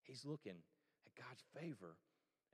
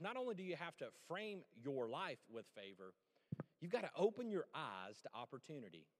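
usual speaking voice

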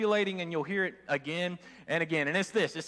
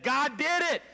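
higher, triumphant exclaim; joyful insistence; 8 semitones higher, slower than usual. Retold or imitated. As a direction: declare it with joy. Woman answers neighbor joyfully.

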